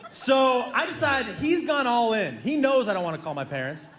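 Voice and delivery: normal talking voice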